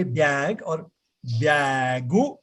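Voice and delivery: BAG as "byag", then "byagu"; y-glide in BAG, then an added u; local pronunciation of BAG. Shown as a demonstration. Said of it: "Why do we say".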